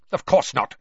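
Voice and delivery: loud curt retort; snapped. A narrator's reading. Snape snapping at Filch, loud and curt. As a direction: bite it off sharply. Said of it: "Snape snapped".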